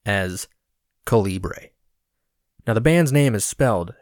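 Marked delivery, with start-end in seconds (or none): 1.0-1.7 imitating the host's Calibre, said as KOH-lee-bray; host's pronunciation. Podcast host naming the band: Calibre pronounced KOH-lee-bray. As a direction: Calibre with a French-like final bray. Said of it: "he introduces the band as".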